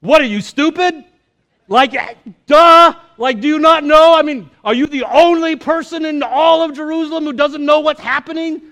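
hammed-up disbelief, duh and all; exasperated Cleopas. Cleopas ranting at Jesus on the road, loud hammed-up exasperation. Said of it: "Do you know what it says?".

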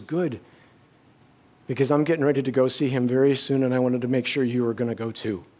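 soft, low imitation of a whispering father; hushed and weak, as from a deathbed; dad's whisper. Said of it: "he's just whispering".

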